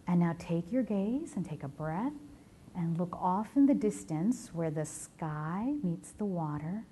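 slow, spaced-out meditation voice; guided meditation. Acted. Guiding the audience to breathe on a sunrise beach, guided meditation. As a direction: ease them gently into the imagery, with long pauses.

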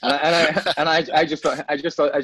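high and loud through real laughter; laughing. Real, not acted. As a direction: talk while still laughing at the story. Laughing at own story.

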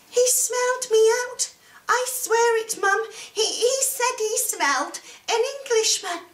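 put-on high-pitched, urgent boy; frightened young Jack. A narrator's reading. Jack swearing to mum about the giant, frightened. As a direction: insist high and panicky, tripping over words.

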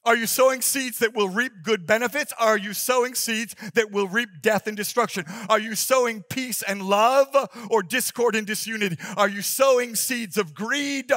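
loud, fervent preacher voice; preaching. Acted. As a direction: preach it with full force. Preaching at full force.

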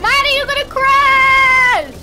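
loud alarmed yell; shout. Real, not acted. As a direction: yell a frantic warning. Warning friend of crash.

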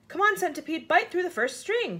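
high, urgent storybook command; brisk, animated order; giving orders. A narrator's reading. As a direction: urge it on, pitched well up. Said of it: "James ordered".

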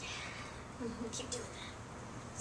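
whispered, slow tempting voice; Satan voice. Retold or imitated. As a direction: hiss it like a tempter. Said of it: "Satan's like".

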